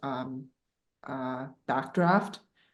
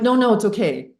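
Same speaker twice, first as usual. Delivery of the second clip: insistent refusal; 6 semitones higher, 7 dB louder than usual. Retold or imitated. Refusing help at the store.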